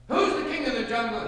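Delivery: loud, high roar of a boastful lion; big-headed lion's booming demand. A narrator's reading. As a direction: roar the question out. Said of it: "he would roar".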